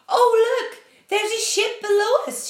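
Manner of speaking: high, loud cry of discovery; excited shout. A narrator's reading. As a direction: shout it out to everyone aboard. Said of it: "shouted James".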